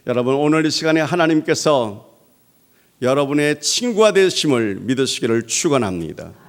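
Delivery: 1 preaching blessing. Acted.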